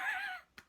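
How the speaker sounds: sudden high shriek of a laugh. Real, not acted. Reader shrieks at the absurd plot, squealing laugh. Incredulous at the book.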